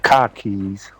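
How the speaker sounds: Boston accent, dropped r in car. Shown as a demonstration. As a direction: say "Car keys" Boston style, like khakis. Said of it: "car in Boston accent".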